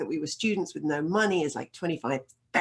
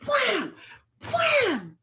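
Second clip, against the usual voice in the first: high, sharp "wham" sound effects; imitated whacks; 4 dB louder than usual. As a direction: voice each blow high and sudden. Acting out the man's blows.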